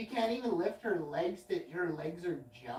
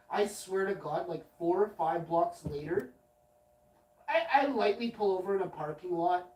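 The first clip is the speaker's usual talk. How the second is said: usual speaking voice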